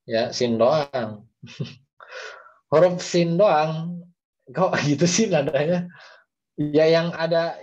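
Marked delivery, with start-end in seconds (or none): 1.4-5.9 laughing. Real, not acted. Teacher laughs at own tune.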